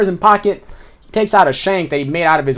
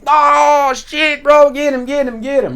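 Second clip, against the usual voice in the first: excited yelling; 10 semitones higher, slower than usual. Retold or imitated. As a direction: cheer the brawl on like someone in the crowd. Inmates cheering on a fight.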